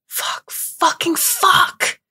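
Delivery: high, slow, cracking cry of fuck; anguished shout. Acted. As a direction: cry it out in despair. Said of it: "I didn't mean to shout".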